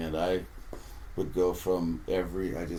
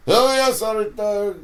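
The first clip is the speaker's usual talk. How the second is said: Corey's voice; 12 semitones higher, 8 dB louder than usual. Retold or imitated. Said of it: "He goes".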